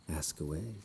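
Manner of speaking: ordinary talk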